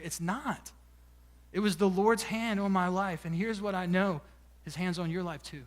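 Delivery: soft, low closing sermon; hushed sincere preaching; quiet preaching. Acted. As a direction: lean in and reassure the congregation gently.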